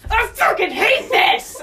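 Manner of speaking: half-laughing mock shout of road rage; frustrated yell. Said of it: "and then all of a sudden you hear".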